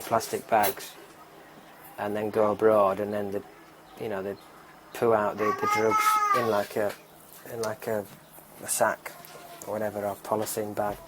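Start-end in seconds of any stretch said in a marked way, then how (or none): none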